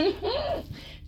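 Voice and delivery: short high giggle; high-pitched little laugh. Real, not acted. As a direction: giggle at your own cheeky remark. Laughs at own remark.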